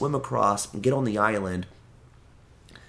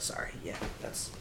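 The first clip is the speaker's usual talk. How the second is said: whispered, embarrassed apology; sheepish whisper; 14 dB quieter than usual. Retold or imitated. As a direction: breathe it out sheepishly. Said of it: "I was like".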